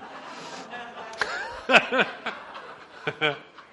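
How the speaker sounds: breathy real laugh at his own slip. Real, not acted. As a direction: laugh it off after the mistake.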